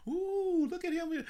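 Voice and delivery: swooning viewers. Retold or imitated. Viewers gushing over a handsome anchor, high swooning. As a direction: gush like a smitten fan. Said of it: "And everybody".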